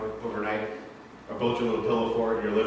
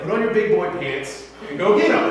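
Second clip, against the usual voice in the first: mock pep talk; 7 semitones higher, 5 dB louder than usual. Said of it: "God's like".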